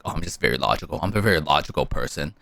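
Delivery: deadpan the know-it-all; mock smug logician. Imitating people who call themselves logical, a low smug drone.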